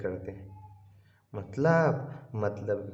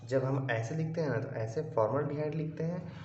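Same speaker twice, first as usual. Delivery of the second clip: ordinary delivery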